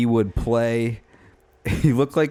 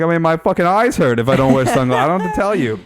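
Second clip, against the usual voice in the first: loud, higher-pitched retold protest; exasperated; 8 semitones higher, 6 dB louder than usual. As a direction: re-enact an exasperated self-defence, louder and higher. Retold exasperated defence of sunglasses.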